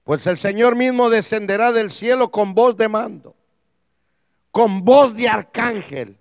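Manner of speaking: loud, declaimed Bible verse; forceful scripture reading. Real, not acted. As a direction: read it out again with full force. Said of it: "oiga, se lo vuelvo a leer".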